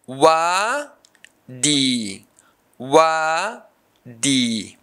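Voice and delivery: "wadi" as long wa, pause, long di; syllable by syllable. Shown as a demonstration. Teacher showing a long-vowel word's syllables, syllable by syllable. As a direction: stretch and separate both halves of "wadi". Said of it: "This word has two syllables".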